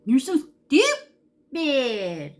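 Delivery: slow, high, loud drawn-out scorn; imitating sister talking down to her; mocking older sister. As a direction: drag it out like a scornful big sister. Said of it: "That's how she talked to me".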